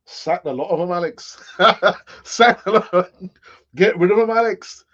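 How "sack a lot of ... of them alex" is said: a hammed-up bad Irish accent, a bit louder; terrible Irish accent. Reading a viewer's chat comment back in a mock Irish accent. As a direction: put on a clumsy Irish lilt to read the chat message. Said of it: "That's, that's a terrible Irish accent".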